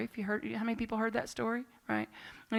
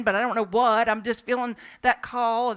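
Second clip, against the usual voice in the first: ordinary talk, nothing marked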